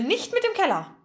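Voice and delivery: high-pitched alarmed protest; slightly shrill cry. A narrator's reading. Margarete protecting the cellar, somewhat shrill. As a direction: shoot the pitch up in objection. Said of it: "rief Margarete etwas schrill".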